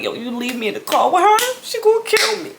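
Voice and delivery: high panicky she gonna kill me; mock panic. Daughter left alone in the car with angry mother, panicking. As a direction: plead in comic panic. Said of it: "I'm like".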